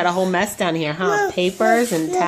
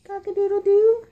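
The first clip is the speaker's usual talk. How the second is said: rooster crow; 14 semitones higher, 4 dB louder than usual. Pretend. Imitating a rooster.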